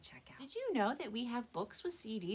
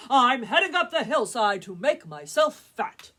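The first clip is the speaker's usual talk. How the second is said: big goat voice; 5 semitones higher, 17 dB louder than usual. Acted. Biggest goat continues.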